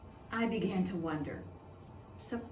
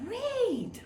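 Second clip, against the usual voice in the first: fake enthusiasm. Boy fakes enthusiasm.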